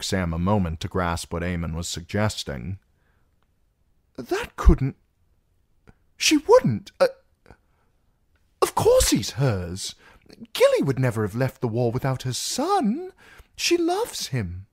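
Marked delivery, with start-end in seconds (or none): 4.2-14.7 shocked stammer. A narrator's reading. Sam shocked, stammering disbelief.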